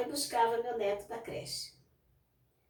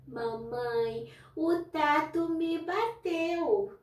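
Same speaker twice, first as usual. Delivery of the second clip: put-on slow, higher whiny little girl; child complaining; 5 semitones higher, much slower than usual. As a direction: drag it out like a small girl tattling. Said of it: "Ela falava o seguinte". (menina pequena se queixando devagar, com voz mais aguda)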